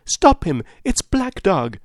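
high, loud alarm call; crying out. A narrator's reading. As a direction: call out urgently to stop a runaway. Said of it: "I cried".

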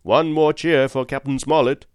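loud cheer call to the crew; rally the men to cheer; rousing cry. A narrator's reading. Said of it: "cried Long John".

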